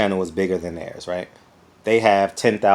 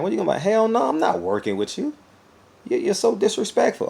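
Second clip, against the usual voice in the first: much higher indignant refusal; mock offended reply; 11 semitones higher than usual. Insulted man refusing a partnership, high indignant voice. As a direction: play the insulted man turning him down. Acts offended target of slurs.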